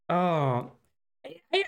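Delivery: ordinary talk, nothing marked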